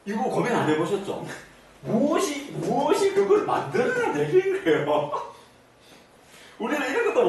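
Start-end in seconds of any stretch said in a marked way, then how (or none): 1.8-5.3 high-pitched question breaking into laughter; laughing as the question ends. Real, not acted. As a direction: ask it through a rising laugh. Laughing while posing the question.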